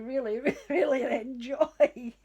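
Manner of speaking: breaking into laughter mid-word; real helpless laughter; laughing. Real, not acted. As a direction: laugh through the admission. Laughing at her own example.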